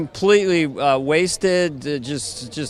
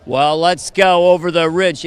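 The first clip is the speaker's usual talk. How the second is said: slow, loud cowboy drawl; John Wayne; 6 dB louder than usual. Acted. British comic mocking Americans, loud slow cowboy impression. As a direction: do an old-western American drawl. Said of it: "sound like John Wayne out there".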